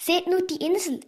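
excited call, pitched high; sudden excitement. A narrator's reading. Julius calls to the others at sight of the island, excited. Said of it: "rief Julius plötzlich ganz aufgeregt".

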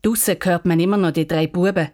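ordinary delivery, nothing marked

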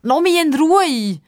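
high, loud angry cry; girl shouting at the bully. Acted. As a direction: yell it and run. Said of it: "ruft Pippa und rennt wütend davon".

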